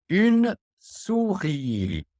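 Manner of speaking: slow, high, carefully articulated "Une souris"; slow model word. Shown as a demonstration. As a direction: say "Une souris" slowly for the class to repeat. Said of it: "Donc, répétez tout le monde".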